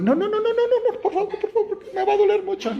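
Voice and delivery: high, frightened begging, half laughing; mock-terrified patient pleading with nurse; cowardly pleading. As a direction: beg the nurse like a scared coward. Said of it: "es un cobarde, como somos los hombres".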